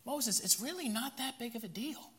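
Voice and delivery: high, soft, placating whine; whiny excuse-making Aaron. Acted. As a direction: talk Moses down like a pleading child. Aaron downplaying it.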